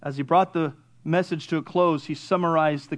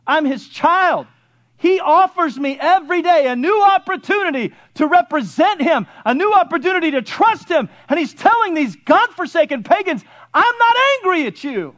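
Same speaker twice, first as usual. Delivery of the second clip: high, emphatic sermon delivery; full-force preaching; 10 semitones higher, 7 dB louder than usual. Acted. Preacher telling church God is not angry, full-force preaching. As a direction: drive each phrase home from the pulpit.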